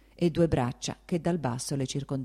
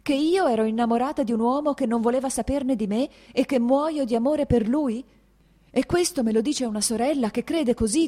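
much higher, louder, furious tirade; bitter furious outburst; 7 semitones higher, 5 dB louder than usual. A narrator's reading. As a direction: hurl it back in bitter fury. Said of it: "diceva con furia".

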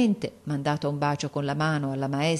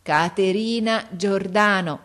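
slow, louder, each syllable pushed out; big voice; 4 semitones higher, 5 dB louder, slower than usual. Acted. Girl presenting her friend to the father, big slow voice. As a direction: call the name out with a big slow voice. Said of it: "dicendo con la sua grossa voce".

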